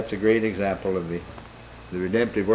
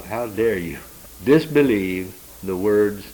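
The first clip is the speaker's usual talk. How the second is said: slow, emphatic reproof, louder on the disbelief; indignant rebuke; 5 dB louder, slower than usual. Retold or imitated. Angel to those who disbelieve God's words, slow indignant rebuke. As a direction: imitate an angel's measured scolding. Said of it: "there is a bit of ... correction here".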